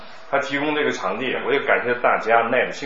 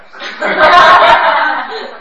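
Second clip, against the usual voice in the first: burst of laughter; 13 dB louder than usual. Real, not acted. Teacher laughs at confusion.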